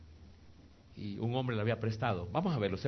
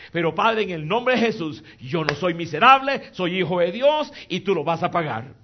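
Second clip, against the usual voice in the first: loud, raised-pitch declaration to God; bold prayer; 7 semitones higher, 11 dB louder than usual. Retold or imitated. Preacher voicing a young man's plea to the Father, bold prayer. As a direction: pray it out forcefully, claiming an answer.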